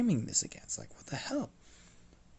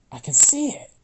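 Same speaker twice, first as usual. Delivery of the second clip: suddenly loud, higher sarcastic jab; loud retort; 7 semitones higher, 12 dB louder than usual. Acted. Smith snapping back at Astrid, loud and sarcastic. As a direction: blurt it out loudly.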